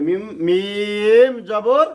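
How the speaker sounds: মিম জবর drawn slowly, each part stretched; slow spelled মিম জবর. Shown as a demonstration. Teacher spells মিম জবর slowly for the class. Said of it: "জোরে, পিছন থেকে আওয়াজ হবে।".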